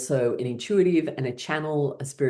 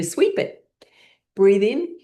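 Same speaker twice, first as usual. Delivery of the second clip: emphatic cue; 7 semitones higher, 5 dB louder, slower than usual. Real, not acted. Energetic breath cue.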